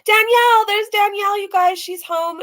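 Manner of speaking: high and loud happy call; light up at seeing her; excited. Real, not acted. Showing viewers the rescued cat's owner, excited. Spots the cat owner's photo.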